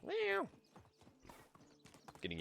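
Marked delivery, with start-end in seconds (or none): 0.1-0.5 squeaky high cat meow; high mock meow. Imitating cat yelling at him.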